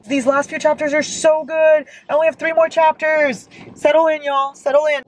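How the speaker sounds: high-pitched, worked-up enthusiasm; gushing excitement. Real, not acted. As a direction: gush to viewers, pitch well up. Thrilled by the last chapters.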